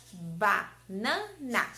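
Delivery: BANANA split into ba, na, na; banana in three separate beats; syllable by syllable. Shown as a demonstration. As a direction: give "banana" piece by piece. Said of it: "quais são os pedacinhos da palavra".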